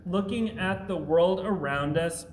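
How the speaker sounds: ordinary talk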